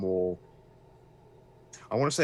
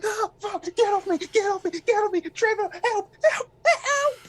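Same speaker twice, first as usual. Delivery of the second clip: high, loud, frantic cries for help; terrified victim screaming, voice shooting way up; panicked screaming; 7 dB louder than usual. Acted. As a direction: scream like you're being dragged away by the ankles. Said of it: "just screaming".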